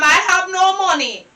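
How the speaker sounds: usual speaking voice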